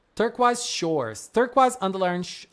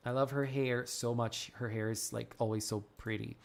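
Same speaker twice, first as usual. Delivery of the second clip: much softer, low adoring murmur; hushed admiration; 7 semitones lower, 11 dB quieter than usual. Real, not acted. Streamer adores the character's hair, hushed admiration. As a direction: breathe it out in awe.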